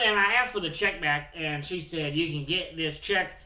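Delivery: rowdy hillbilly Bill bragging about his wedding news. Acted. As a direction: play the brash trailer-park groom, pushed up and loud. Character Bill Morgan holds voice.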